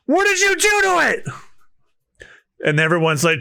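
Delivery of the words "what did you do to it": shocked yell. Acted. Crew yelling at Luffy.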